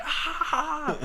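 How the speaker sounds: high, straining flex yell; mock muscle-flex cry; flexing grunt. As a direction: show off with a grunt. Flexing at the crowd.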